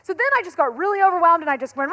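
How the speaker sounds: usual speaking voice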